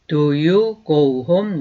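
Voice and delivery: slow, clear teacher's model, each word apart; slow demo pronunciation; slow model sentence. Shown as a demonstration. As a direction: say it slowly for students to repeat. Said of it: "하고 물어보게 돼요".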